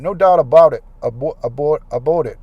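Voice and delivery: put-on another go at a Canadian accent, loud, stumbling on "Abo-, abo-" before "about" comes out as "aboot"; mock Canadian accent. Vlogger retrying the Canadian accent for his fans, a loud, stumbling mock Canadian accent. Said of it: "Canadian accent down".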